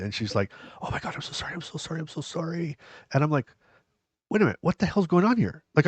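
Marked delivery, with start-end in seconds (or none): 0.8-2.8 hushed, whispery string of sorries; very quiet apology. Retold or imitated. Cleaner apologizing for startling him, very quiet. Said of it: "but she's very quiet". 4.3-5.6 retold startled confusion. Retelling his startled confusion.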